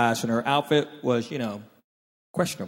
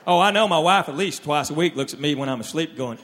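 usual speaking voice

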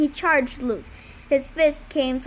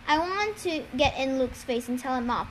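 ordinary delivery

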